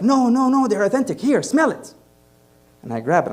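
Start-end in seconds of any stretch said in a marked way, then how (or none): 0.0-1.9 reassuring seller. Retold or imitated. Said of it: "And he's like".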